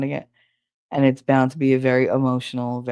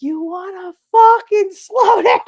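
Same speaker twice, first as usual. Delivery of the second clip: very high, loud, slow, laughing yell; retold yell; 9 dB louder than usual. Brother yelling at his girlfriend to slow down, shrieking high. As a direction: shriek it like a panicked passenger.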